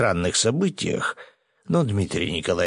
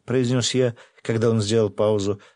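usual speaking voice

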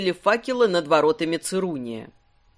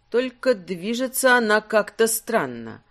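usual speaking voice

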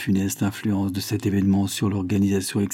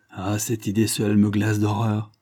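plain reading voice

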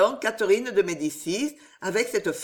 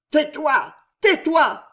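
loud, higher, furious hush; growled command; 7 semitones higher, 7 dB louder, slower than usual. Acted. The queen furiously silencing the stammering astrologer. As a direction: silence him in a rage. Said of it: "gronda-t-elle".

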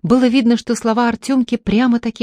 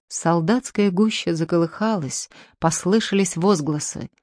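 ordinary delivery

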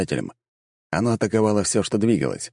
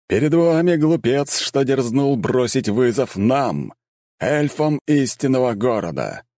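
powerful herald; 5 semitones higher than usual. A narrator's reading. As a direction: denounce the fool before the crowd. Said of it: "В этом звучала сила, мощь и осознание величия".

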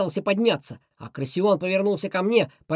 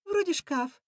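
high, soft, unsure answer; quiet high-voiced schemer, vague; offhand villain reply; 11 semitones higher, 5 dB quieter, slower than usual. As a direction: answer casually in a high cartoon voice.

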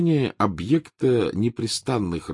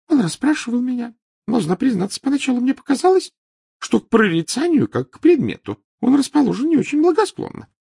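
Trelawney voice; 7 dB louder than usual. Acted.

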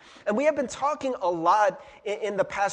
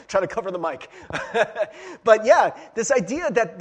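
laughing; 6 dB louder than usual. Real, not acted. Laughs after failed sneeze.

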